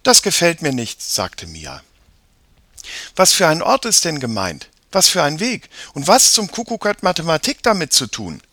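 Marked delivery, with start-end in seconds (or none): 0.0-1.0 put-on high, loud child's objection; displeased girl. A narrator's reading. Sister objects to the strange poem, displeased. 3.1-8.4 high, loud string of questions; indignant girl. A narrator's reading. Sister challenges the puzzling poem, indignant.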